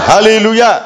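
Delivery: high-pitched Hallelujah call to the congregation; rallying acclamation. Real, not acted. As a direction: call it out high and sustained for all to answer. Urges congregation to repeat Hallelujah.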